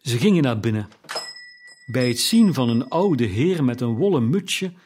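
ordinary delivery, nothing marked

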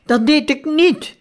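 high, loud shout of denial; loud, high-pitched outraged denial. A narrator's reading. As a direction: yell it back, stung. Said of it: "schreeuwde Moritz".

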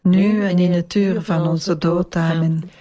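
measured rosary response in unison; praying aloud as a congregation; prayer recitation. Real, not acted. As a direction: recite it together, evenly and devoutly. Group answers the Hail Mary.

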